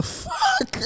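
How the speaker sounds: high squeaky disbelief. Retold or imitated. Mock shocked squeal at friend's outburst. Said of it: "I was like".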